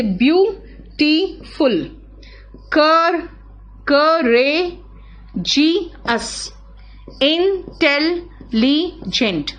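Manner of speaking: Beautiful, courageous, intelligent each drawn out very slowly; teacher slowly spelling out the example words; slow, stretched examples. Shown as a demonstration. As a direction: stretch every example word so the class hears its syllables. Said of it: "देख रहे हो?".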